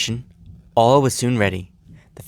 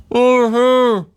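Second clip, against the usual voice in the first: very high, loud muffled hum; muffled cry; 6 dB louder than usual. Acted. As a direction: call out from under snow. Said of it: "cried a familiar but muffled voice".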